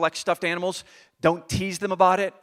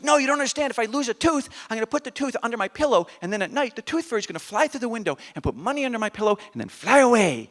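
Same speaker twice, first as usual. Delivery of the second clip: put-on high-pitched, earnest kid voice; seven-year-old explaining; 5 semitones higher than usual. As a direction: explain it like an excited small child. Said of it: "he starts explaining to me and his brother".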